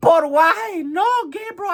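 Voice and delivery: hammed-up, very high pleading wail; heartbroken Maria. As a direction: wail in mock heartbreak, starting loud. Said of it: "Maria's like".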